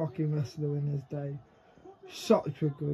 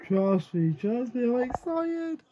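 excited; 6 semitones higher than usual. Real, not acted.

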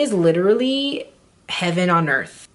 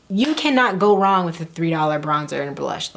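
ordinary talk

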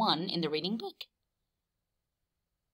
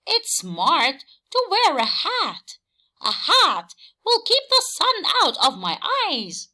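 high, louder Horse explaining; Horse's voice; 9 semitones higher, 5 dB louder than usual. A narrator's reading. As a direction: make the case for a hat as Horse. Horse explains wanting hat.

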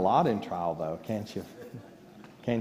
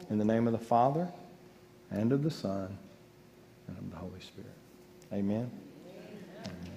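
softer and lower, fading prayer; benediction; 3 semitones lower, 7 dB quieter than usual. Acted. Closing the service with a blessing, a soft fading prayer. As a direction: bless them quietly as it fades.

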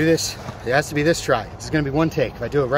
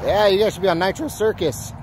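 voice cracking; 6 semitones higher than usual. Real, not acted. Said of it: "You hear that voice crack?".

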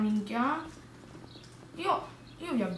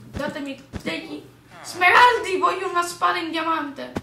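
loud, high eager demands; excited shouting; 6 semitones higher, 9 dB louder than usual. Real, not acted. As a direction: shout for the loot. Demanding emeralds loudly.

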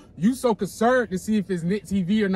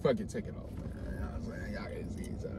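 whispered under the breath; whispered aside; 7 semitones lower, 15 dB quieter, faster than usual. Real, not acted. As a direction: whisper so the guest can't hear. Aside during the blindfold reveal.

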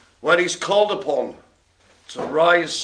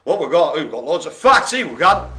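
ordinary talk, nothing marked

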